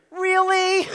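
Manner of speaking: high, loud exasperated "Really?"; exasperated call. Retold or imitated. Wife yells from the kitchen, loud and exasperated. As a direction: call out fed up from the next room. Wife calling from another room.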